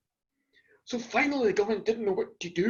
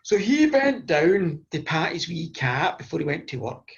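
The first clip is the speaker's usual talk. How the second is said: usual speaking voice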